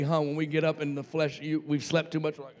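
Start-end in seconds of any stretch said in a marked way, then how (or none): none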